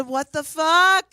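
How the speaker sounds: stunned yell. Retold or imitated. To the bartender over his bank account, a loud slow shocked yell. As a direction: drag out the disbelief, shouting. Seeing the minus two million.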